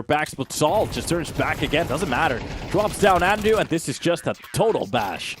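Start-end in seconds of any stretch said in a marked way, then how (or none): 2.7-5.4 higher and louder hype call over the kill; excited shout. Real, not acted. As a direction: yell it as the round swings.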